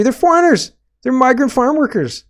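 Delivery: high, loud, sneering mock voice; parodying an alarmed news anchor; mock outrage. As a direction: squeal it out in fake shock. Mocking the news report.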